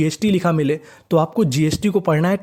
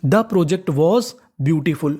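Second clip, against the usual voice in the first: slow dictation; much slower than usual. Shown as a demonstration. Teacher dictating the English sentence.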